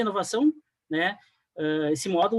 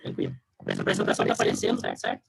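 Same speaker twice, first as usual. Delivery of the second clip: choppy, cutting-out audio; corrupted glitchy audio; faster than usual. Real, not acted. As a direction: voice breaking up over a bad connection. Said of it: "O áudio tá cortando um pouquinho agora".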